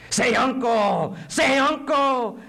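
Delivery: loud, high, drawn-out command; torturer demanding surrender. Acted. Interrogator forcing a prisoner to give in, loud slow shout. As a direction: shout it slowly, twice, like an order.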